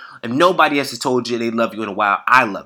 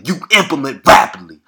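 louder, driving it home; emphatic, raised voice urging action; loud emphatic repeat; 13 dB louder than usual. Real, not acted. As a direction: hammer the line home to listeners.

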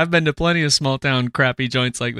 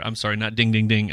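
ordinary talk

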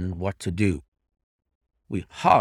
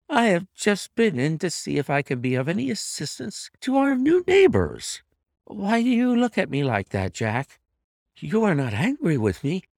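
high-pitched, anxious wife; 7 semitones higher than usual. A narrator's reading. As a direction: plead innocence in a high wife's voice. Wife explains herself to husband.

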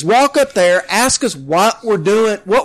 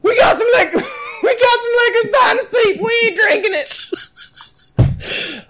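laughing mock confession; 11 semitones higher than usual.

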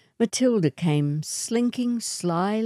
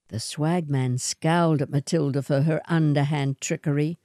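usual speaking voice

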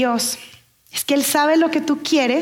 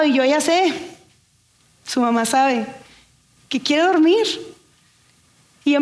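usual speaking voice

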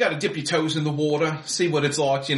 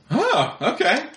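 guard voice; 9 semitones higher, slower than usual. Acted. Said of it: "he just goes".

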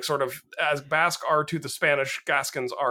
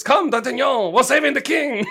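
hammed-up French accent, high and loud; rallying cry in a showy French accent; Oliver Platt's French accent; 8 semitones higher, 5 dB louder than usual. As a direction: call out theatrically with a comic French accent. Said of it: "Oliver Platt does a French accent".

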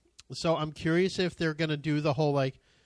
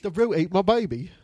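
put-on joking Australian accent, slightly louder; mock Australian accent; 2 semitones higher, 5 dB louder than usual. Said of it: "Just choose Australian".

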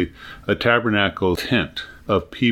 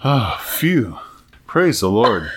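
relieved after a close call; slower than usual. A narrator's reading. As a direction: sigh of relief, easing down.

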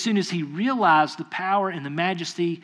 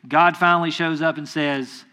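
ordinary talk, nothing marked

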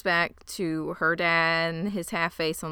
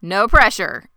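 coach; 2 semitones higher, 11 dB louder, slower than usual. Retold or imitated. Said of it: "He's like".

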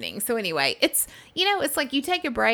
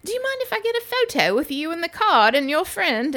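imitating British guy; 9 semitones higher than usual. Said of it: "And he was like".

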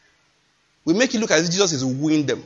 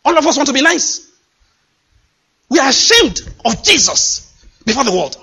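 fervent preaching; 6 semitones higher, 5 dB louder than usual. Real, not acted. Preaching at full force.

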